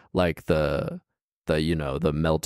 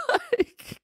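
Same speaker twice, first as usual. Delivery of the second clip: high burst of laughter; squealing laugh. Real, not acted.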